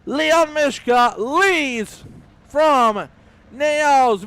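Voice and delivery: driving sports-commentator name call; race grid announcer. Real, not acted. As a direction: announce each driver with big energy. Announcer calls the starting grid.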